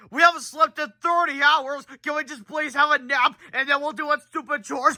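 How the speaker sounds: put-on high, whiny exhausted kid voices. Boys begging parents for a nap, high whine. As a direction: beg in a high pleading whine. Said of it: "George and Hal just like".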